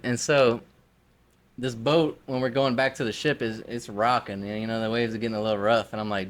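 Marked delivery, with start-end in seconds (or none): none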